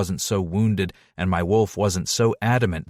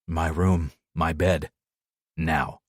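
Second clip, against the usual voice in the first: lightly voiced lover, low and terse; low command; 5 semitones lower, slower than usual. Deke tells her where he is carrying her, low and terse.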